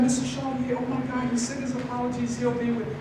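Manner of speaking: put-on high, soft, apologetic old lady; sweet grandmotherly receptionist. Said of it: "kinda like a grandmother, just really nice".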